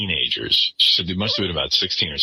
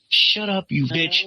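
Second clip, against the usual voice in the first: mock street accent; 12 semitones higher, slower than usual. Retold teen insulting Beth.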